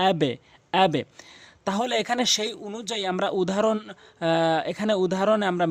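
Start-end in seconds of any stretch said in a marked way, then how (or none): 0.1-1.0 আবে with a short "e" bounce on the b; downward qalqalah. Shown as a demonstration. Teacher modelling ba for learners, downward bounce on আবে. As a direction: push the echo of আবে down to "e". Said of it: "নিচের দিকে sound করব".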